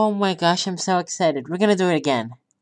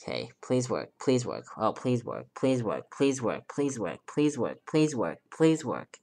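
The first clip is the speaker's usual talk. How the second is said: soft, low repeated pleading; quiet pleading; 6 semitones lower, 8 dB quieter than usual. Real, not acted. Gamer quietly begging his ball run to work. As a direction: beg it under your breath.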